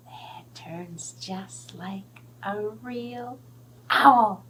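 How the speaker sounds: slow, soft whisper of wonder; hushed whisper. Acted. Showing the puppet to children, slow whisper. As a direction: breathe it out in awe.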